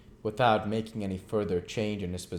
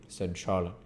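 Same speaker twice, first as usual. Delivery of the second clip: plain reading voice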